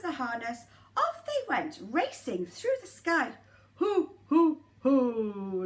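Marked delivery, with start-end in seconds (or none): 3.8-5.6 loud ho-ho laugh; booming jolly Santa laugh; Santa's laugh. Acted. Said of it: "laughed Santa".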